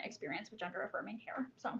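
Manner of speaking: soft, fast whisper. Real, not acted. Telling the church audience about her heavy heart, in a whisper. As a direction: let it trail off into a whisper.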